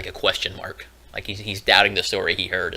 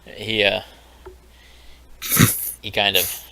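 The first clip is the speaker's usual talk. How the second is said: ordinary talk, nothing marked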